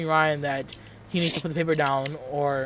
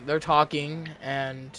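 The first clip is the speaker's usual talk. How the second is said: normal talking voice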